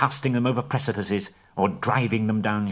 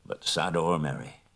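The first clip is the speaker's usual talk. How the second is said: low, subdued dwarf king; dying Thorin; 4 semitones lower than usual. Acted. Dying Thorin blesses Bilbo.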